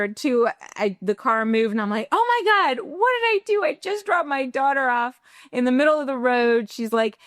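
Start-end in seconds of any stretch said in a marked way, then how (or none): 2.1-6.7 high, frantic panic; mother realizing she left her child; panicked. Retold or imitated. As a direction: gasp it out in sudden horror.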